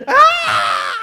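put-on high, loud wailing child's scream; a kid losing it over a folded drawing; tantrum scream. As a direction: let out a toddler's furious wail. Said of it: "She went ballistic".

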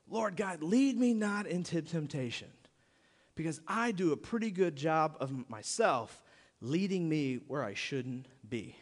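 quiet prayer. Acted. Worshipper admitting to God he leads himself astray, quiet. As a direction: pray it quietly, owning the fault.

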